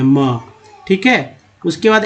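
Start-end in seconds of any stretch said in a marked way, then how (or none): 0.0-0.4 म said alone as a bare m sound; म pronounced as a short consonant. Shown as a demonstration. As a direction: give म, not the letter name एम. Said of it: "उच्चारण जो है म करते हैं".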